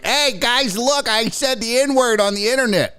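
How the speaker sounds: a hint of a snotty teen showing off; mocking kid voice. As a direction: sneer at the troll by playing him. Mocking a thirteen-year-old troll.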